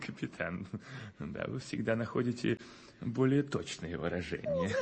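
soft laughing, teasing flattery. Acted. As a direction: chuckle through it, teasing. (со смехом)

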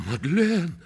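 high, loud call of her name; dismayed cry. Acted. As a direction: cry out in protest to your beloved. Suitor appalled at her answer. (отчаянный возглас)

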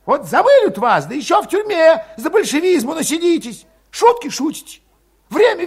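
high, loud, scornful tirade; heated rant. A narrator's reading. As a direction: scold them all, high-pitched and loud. Valet rants at comrades.

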